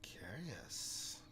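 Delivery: soft, drawn-out whisper; ghostly whisper. Acted. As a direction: breathe it like a ghost. Sith spirit whispers in reply.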